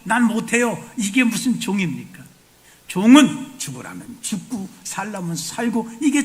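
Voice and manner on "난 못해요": loud, higher refusal talking back; a servant snapping back at his master; defiant servant. Acted. A mock servant loudly refusing his master's order. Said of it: "종이 어떻게 주인한테".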